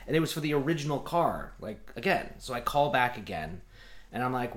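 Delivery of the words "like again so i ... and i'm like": ordinary delivery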